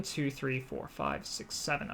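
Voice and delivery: counting down softly; quiet counting. Shown as a demonstration. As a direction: fade the count to a near whisper. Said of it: "Okay, you have enough range".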